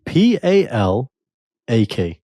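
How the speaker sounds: slow letter-by-letter P A L A K; spelled out slowly. Shown as a demonstration. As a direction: spell P A L A K out deliberately. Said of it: "How, how you say it?".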